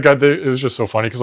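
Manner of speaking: normal talking voice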